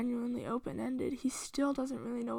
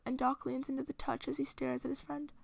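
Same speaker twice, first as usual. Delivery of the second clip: usual speaking voice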